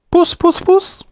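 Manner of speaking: very high, light cat call. A narrator's reading. Saucepan man searching for a cat, calling high.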